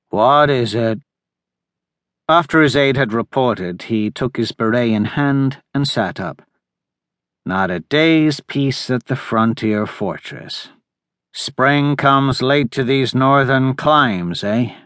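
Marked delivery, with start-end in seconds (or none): 0.1-1.0 sleepy muffled. Acted. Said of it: "in a sleepy, muffled voice". 7.5-14.8 slow weary musing. Acted. Weary admiral musing aloud.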